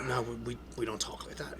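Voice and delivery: low hushed whisper; whispered warning. Retold or imitated. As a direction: lean in and whisper it. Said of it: "leaned in and goes".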